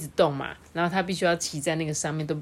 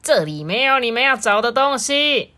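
high, loud dismissive voice; grumpy farmer; 7 semitones higher, 6 dB louder than usual. A narrator's reading. Farmer to snooping friends, loud grumpy dismissal. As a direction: send them off irritably. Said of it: "农夫哈肯皮啊".

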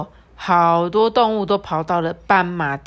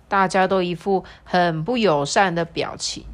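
usual speaking voice

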